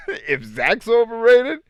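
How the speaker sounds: high, loud, drawn-out disbelief; incredulous. Real, not acted. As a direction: squeak it out in disbelief. Incredulous comeback in debate.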